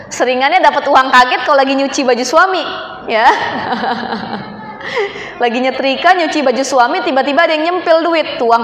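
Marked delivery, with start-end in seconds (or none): 3.3-5.4 laughter. Real, not acted. Laughs at own joke.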